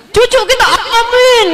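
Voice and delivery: high, laughing mockery of a hopeless amen. Preacher mocking hopeless parents, laughing, high.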